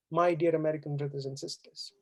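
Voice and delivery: usual speaking voice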